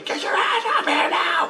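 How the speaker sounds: very high mock shriek; wife yelling. Wife yells at husband to return, high mock yell. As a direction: imitate a wife's shrill-pitched yelled order. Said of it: "My wife's yelling at me".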